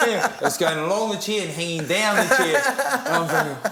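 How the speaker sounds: ordinary delivery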